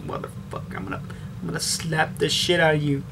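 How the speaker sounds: muttering about slapping a noisy eater, hushed; muttered threat. Retold or imitated. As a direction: grumble it to yourself so nobody hears. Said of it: "I was like".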